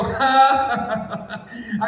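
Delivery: acted giant's "ha ha ha ha"; mocking laugh. Goliath laughs at young David, mocking. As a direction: laugh scornfully, starting high and dying away. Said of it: "Goliat se rió".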